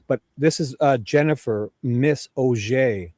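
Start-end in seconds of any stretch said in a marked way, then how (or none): 1.8-3.1 Auger said oh-ZHAY, slowly; French-sounding Auger. Shown as a demonstration. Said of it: "So sounds French".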